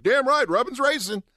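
high, mock Southern twang. Fans agreeing with their Southern driver, imitated twang. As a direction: cheer it on like a Southern race fan. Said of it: "Logano needs to talk with a twang".

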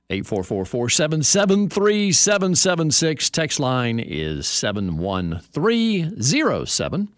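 high, punchy station-ID delivery; radio host announcing. Real, not acted. Host taking the show to break and back, announcer style. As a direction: sell the phone numbers in a rhythmic broadcast sing-out.

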